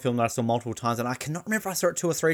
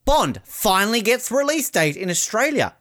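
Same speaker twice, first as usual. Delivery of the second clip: mocking headline; 7 semitones higher than usual. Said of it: "their headline was".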